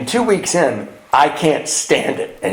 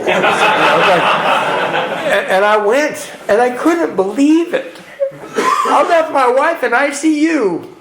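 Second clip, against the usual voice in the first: laughing in disbelief; 9 semitones higher, slower than usual. Real, not acted. Laughing while telling.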